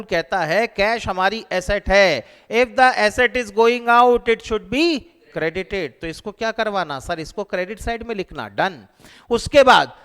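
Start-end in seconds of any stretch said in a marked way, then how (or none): 2.5-5.9 the golden rule drawn out word by word; slow, spaced-out dictation. Shown as a demonstration. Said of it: "हमारा golden rule".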